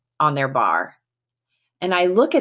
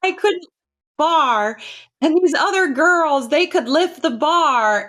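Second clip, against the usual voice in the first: high, tearful mock wail; re-enacted sobbing complaint, pitched way up; imitated crying; 10 semitones higher than usual. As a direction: wail it like someone crying too hard to talk. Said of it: "uncontrollably crying".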